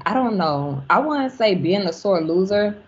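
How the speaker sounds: ordinary talk, nothing marked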